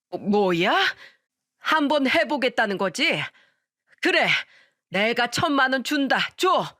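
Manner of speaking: high, stammering, rising indignation; flustered angry retort. A narrator's reading. Father-in-law on the phone, provoked by the recording, bursts out angrily. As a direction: bluster back, caught off guard.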